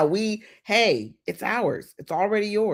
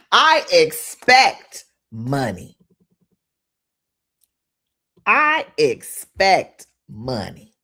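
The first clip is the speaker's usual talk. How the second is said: louder, slow declaring affirmations; firm affirmation; 4 semitones lower, 4 dB louder, much slower than usual. Shown as a demonstration. Host declaring money affirmations with the audience, slow and strong. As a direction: proclaim it with conviction. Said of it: "it makes me wanna say it with y'all".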